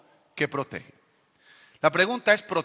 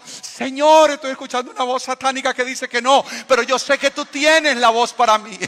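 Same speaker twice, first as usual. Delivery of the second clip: pleading believer; 7 semitones higher, 7 dB louder than usual. Pretend. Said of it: "pleading with God".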